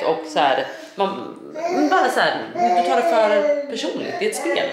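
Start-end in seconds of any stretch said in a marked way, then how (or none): 1.9-4.7 higher, laughing through the words; laughing mockery. Real, not acted. Vlogger laughing at Love Island contestants. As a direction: chuckle as you scold them for taking it personally.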